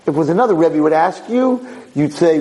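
usual speaking voice

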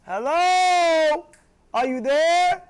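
high, loud, drawn-out "hello"; calling out. Acted. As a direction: holler as if to get someone's attention. Calls out to the screamer.

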